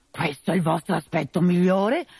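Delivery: usual speaking voice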